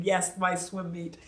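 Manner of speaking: ordinary talk, nothing marked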